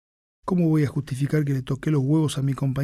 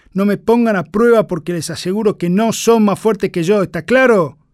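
high, loud furious drill sergeant; harsh barking commands; 5 semitones higher, 8 dB louder than usual. Acted. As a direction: berate the recruits at full shout. Sergeant berating recruits.

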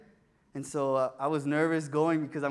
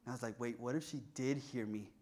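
worried murmur; 6 semitones lower, 12 dB quieter than usual. Retold or imitated. Anxious overthinking.

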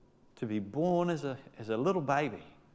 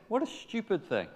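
ordinary talk

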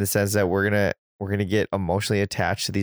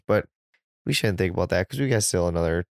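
ordinary delivery

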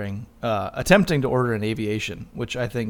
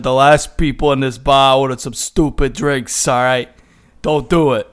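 bad tough-guy Boston accent, loud; loud, hammy Boston bartender warning; 2 semitones higher, 9 dB louder than usual. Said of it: "really terrible Boston accent".

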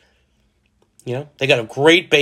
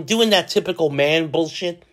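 ordinary talk, nothing marked

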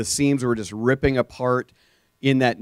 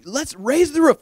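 excited; 13 semitones higher than usual. Retold or imitated. Pastor rallying the crowd.

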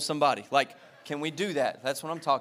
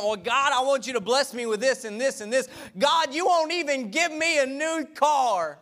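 whining to God; 6 semitones higher, 4 dB louder than usual. Retold or imitated. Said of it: "believer complaining to God".

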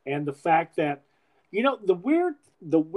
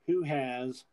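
normal talking voice

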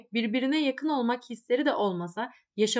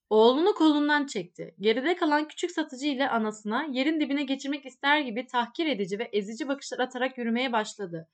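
usual speaking voice

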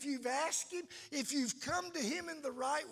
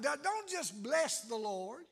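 normal talking voice

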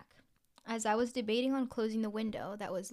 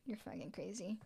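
whispered; 10 dB quieter than usual. Real, not acted. Disbelief at friend.

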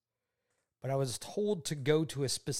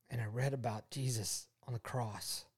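ordinary talk

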